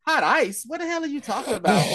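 mock confused outburst.

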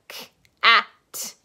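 K, at, split into sounds; sounds split apart. Shown as a demonstration. Teacher splits the word into its sounds for kids. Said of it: "Those are our sounds".

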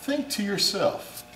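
high, loud indignant appeal. Acted. Boss defending himself.

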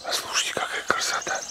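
soft unvoiced whisper. Real, not acted. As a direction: whisper so as not to scare birds. Quiet morning in the woods.

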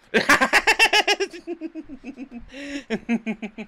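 long high-pitched cackle; real laughing fit. Real, not acted. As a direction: crack up helplessly at your own story. Cracks up at own story.

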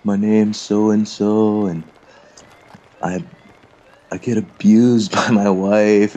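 a hint of a meek man confessing at the meeting; timid sharer. Imitating the slim man telling the AA meeting about his wife, slow and meek. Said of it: "he stands up and he's like".

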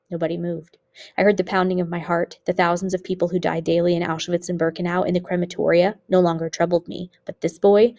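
ordinary delivery, nothing marked

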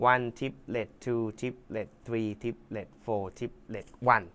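very slow, quiet triplet count; counting along slowly and softly for the student. Shown as a demonstration. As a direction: spell out each triplet slowly. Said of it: "สามพยางค์".

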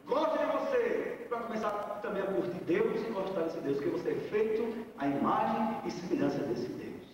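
ordinary delivery

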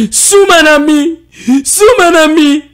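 hoog, luid, door tranen heen geschreeuwd; huilend schreeuwen. Acted. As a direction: schreeuw het uit tussen de snikken. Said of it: "Tussen de tranen schreeuwde ze". (Mofina schreeuwt huilend terug naar Ma)

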